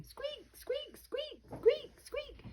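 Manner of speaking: tiny squeaking noises, very high; high squeaks. A narrator's reading. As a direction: squeak like a baby bird in the egg. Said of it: "this egg is squeaking".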